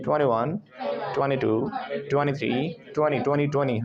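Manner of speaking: "ट्वेंटी" as "twen-nee" each time; silent T. Shown as a demonstration. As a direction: drill "ट्वेंटी" with no T after N. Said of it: "N के बाद टी गायब कर दो।".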